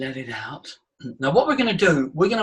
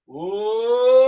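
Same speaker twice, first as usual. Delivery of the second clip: oh gliding upward and swelling louder; climbing sustained oh; higher oh; 5 semitones higher, 4 dB louder than usual. Shown as a demonstration. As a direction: push the oh as high as you can. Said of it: "go higher than that".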